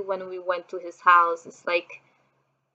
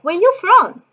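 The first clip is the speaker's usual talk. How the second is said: high, louder, imitated curious stranger; retold eager question; 10 semitones higher, 6 dB louder than usual. Woman approaching on a park bench, mock-eager high question. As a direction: pipe up brightly as the stranger asking.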